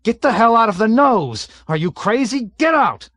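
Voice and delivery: high, loud frantic yelling; panicked yelling. A narrator's reading. Panicked pilot yells at navigator under flak. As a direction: shout him out of the nose in terror.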